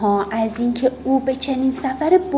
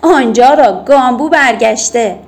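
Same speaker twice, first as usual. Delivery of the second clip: put-on a kid yells to the others, clearly louder; shouted call; 8 dB louder than usual. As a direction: call out across the playground. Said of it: "یکی داد زد".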